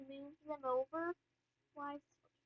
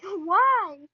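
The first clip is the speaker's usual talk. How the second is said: much louder, drawn-out "why"; loud mock interrogation; retold loud question; 3 semitones higher, 16 dB louder than usual. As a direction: imitate a mom demanding an explanation.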